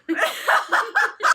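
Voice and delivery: shrieks of laughter, high and loud; loud shrieking laugh. Real, not acted. Reader collapsing into laughter over the slap scene. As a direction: burst out screaming with laughter. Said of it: "Wait, I can't".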